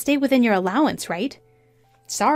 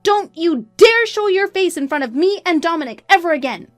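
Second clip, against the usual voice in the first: high, loud, sustained rage; furious tirade; 10 semitones higher, 7 dB louder than usual. A narrator's reading. Wife cutting off her cheating husband, furious. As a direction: tear into them without holding back.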